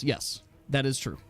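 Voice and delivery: usual speaking voice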